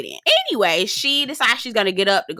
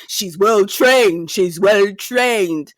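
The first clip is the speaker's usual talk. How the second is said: slow, drawn-out mimicking drawl; mock him by stretching every word; exaggerated slow imitation; mocking impression; 3 semitones lower, 4 dB louder, much slower than usual. Mimics Kimma boasting about his wife.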